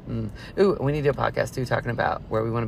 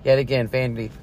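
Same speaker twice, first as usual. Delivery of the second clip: ordinary delivery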